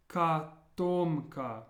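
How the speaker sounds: "Katomka" slowly, syllable by syllable, kah-TOM-ka; slow demo of "Katomka" with an ah in the first syllable; unstressed O as A. Shown as a demonstration. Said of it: "first O is pronounced more like an A".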